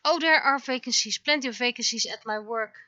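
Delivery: normal talking voice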